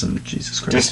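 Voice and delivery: whispered in disbelief. Real, not acted. Reader stunned by the last sentence, whispered. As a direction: breathe it out aghast.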